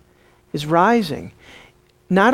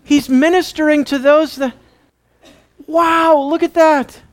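high, gushing mock amazement; awed admiration; 6 semitones higher than usual. Imagined scribes admiring the ministry, gushing high. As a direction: draw out the wow, hugely impressed. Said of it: "how do you think they responded?".

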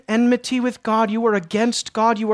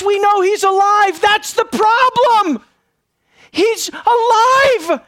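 higher, louder, slow pleading cry; fervent preaching; 6 semitones higher, 4 dB louder, slower than usual. Acted. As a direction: build into a desperate cry. Preacher builds to crescendo.

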